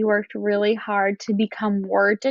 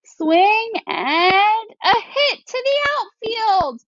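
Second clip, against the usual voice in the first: much higher, slow, triumphant; thrilled call; 10 semitones higher, slower than usual. Shown as a demonstration. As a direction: call the big hit like a thrilled announcer. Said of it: "change my voice to match".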